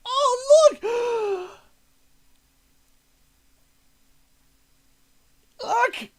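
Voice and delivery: high, excited shout of look; real excited outburst at snowballs in the community tab. Real, not acted. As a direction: call out in sudden delight.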